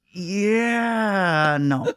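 long drawn-out high yeah, then a plain no; reluctant refusal. Retold or imitated. Comic turning down the prince's gig, reluctant. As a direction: stretch the yeah as if weighing it, then drop to no.

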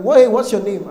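acted shock, voice jumping up; startled demand. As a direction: demand the name in loud disbelief. Women startled, ask again sharply.

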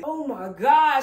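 stunned loud exclaim; loud oh my god. Real, not acted. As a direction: blurt it out in disbelief. Overwhelmed by gift.

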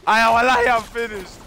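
high, loud wailing complaint; loud frantic lament; despairing shout. Real, not acted. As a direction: cry out as you go down. Getting killed by squad.

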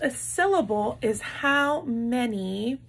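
normal talking voice

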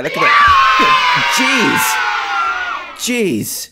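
very high, loud startled scream; jump-scare yell. Real, not acted. Player hit by a jump scare, startled scream. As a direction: shriek in shock, then a high gasped curse.

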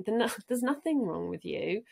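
normal talking voice

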